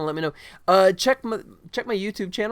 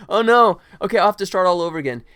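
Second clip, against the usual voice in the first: louder hammed-up dismay, rushing; mock panic; 4 semitones higher, 8 dB louder, faster than usual. Streamer joking about the closed stream, mock panic. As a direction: pretend the stream just died. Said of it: "No, I'm just kidding".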